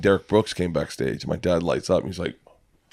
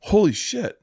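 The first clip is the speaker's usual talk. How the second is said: high, stunned exclamation; amazed holy shit; 11 semitones higher, slower than usual. Retold or imitated. Hearing Derek Brooks saw his first show, a high stunned cry. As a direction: let it burst out high in disbelief. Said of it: "And I was like".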